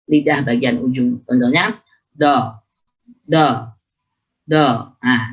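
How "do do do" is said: "do" from the right place, without full heaviness; short contrasting "do"; dhad lacking its qualities. Shown as a demonstration. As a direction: demonstrate an incomplete "do". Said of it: "sifat tak ikut".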